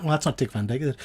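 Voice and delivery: usual speaking voice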